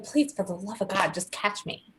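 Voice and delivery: whispered, pleading softly; hushed mock plea. Killer pleading with police, whispered mock desperation. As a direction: beg under your breath.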